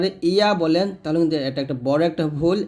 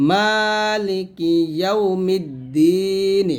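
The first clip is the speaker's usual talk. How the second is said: slow, drawn-out teaching recitation; measured chant, each syllable stretched; Quran recitation; 4 semitones higher, much slower than usual. Shown as a demonstration. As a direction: recite with long held vowels. Recites the verse.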